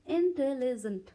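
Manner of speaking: इंटेलिजेंट slowly sounded out, in-te-li-jent; इंटेलिजेंट drawn out in separate parts; broken into syllables. Shown as a demonstration. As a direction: say इंटेलिजेंट one syllable at a time. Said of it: "कितने parts हो गए इसके?".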